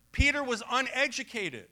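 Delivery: ordinary delivery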